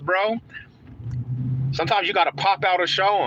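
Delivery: rising playoff excitement; hyped up. Real, not acted. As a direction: gloat to Fizzle that they're in. Hyping Broncos playoff berth.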